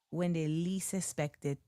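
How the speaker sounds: put-on light West Indian accent, measured pace. Author warning about the book's release, in a West Indian accent. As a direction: put on a West Indian lilt, pausing before the payoff. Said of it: "a West Indian accent felt like that was needed".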